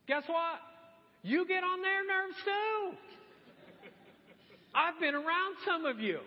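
teasing jab. Real, not acted. Pastor teasing his congregation, high and slowed.

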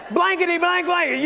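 resumed angry rant. Acted. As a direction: snap back into the argument. Resumes acted quarrel after phone call.

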